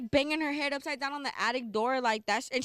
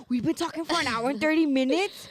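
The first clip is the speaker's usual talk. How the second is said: shocked disbelief; 4 semitones higher, 6 dB louder than usual. Retold or imitated. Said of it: "Oh, I was like".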